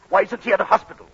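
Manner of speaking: irritated outburst; exasperated protest. Acted. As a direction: object sharply to the guest. Husband protests sick man's presence.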